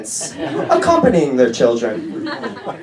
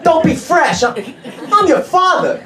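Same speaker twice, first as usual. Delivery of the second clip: imitating dad, much higher and offended; indignant dad; 9 semitones higher than usual. Father scolding his son for calling him a Carducci, indignant. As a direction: scold the son, hammed-up outrage.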